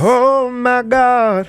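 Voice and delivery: high, loud, drawn-out exclamation; theatrically aghast, held on a high note; mock shock. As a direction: stretch it out in hammed-up horror. Hosts' mock-dramatic reaction to story.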